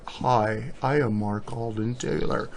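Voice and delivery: soft, low mock podcast introduction; hushed, deliberately boring radio-host parody; boring host intro. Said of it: "don't wanna hear us talk like".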